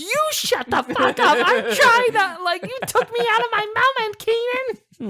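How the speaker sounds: very high, yelled comeback; hammed-up furious squawk; indignant retort. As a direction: screech back at your friend, outraged. Acted angry retort to friend.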